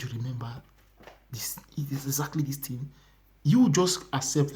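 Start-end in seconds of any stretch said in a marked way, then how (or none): 0.0-2.9 soft, whispery quoted reminder; low private murmur; hushed reminder. Retold or imitated. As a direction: voice God quietly prodding the memory. Voicing God reminding a sinner.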